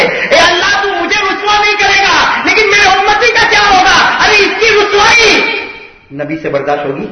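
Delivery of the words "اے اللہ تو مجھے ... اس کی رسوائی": high, loud impassioned shouting; preaching at full force. Acted. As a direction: pour everything into the sermon. Preacher's rising climax. (پورے زور سے پرجوش خطابت)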